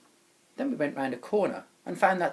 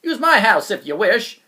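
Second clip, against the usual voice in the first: character voice; 3 semitones higher, 12 dB louder than usual. Acted. Character offers shelter, voiced.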